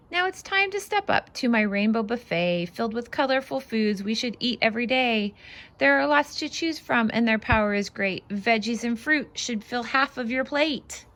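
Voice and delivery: lively, bouncy read-aloud voice; singsong picture book reading; reading to children. Acted. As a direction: read it out like a storybook for little ones.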